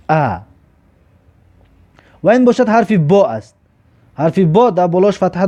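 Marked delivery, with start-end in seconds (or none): 0.1-0.4 "ا" read as an open "a"; hamza with fatha. Shown as a demonstration. Teacher shows how hamza with fatha is read, "ا" as "a". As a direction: sound out "ا" as a lone "a".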